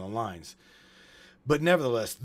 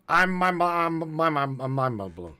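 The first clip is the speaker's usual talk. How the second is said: mind-blown stammer; 4 semitones higher, 5 dB louder than usual. Pretend. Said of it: "what I just tried to say".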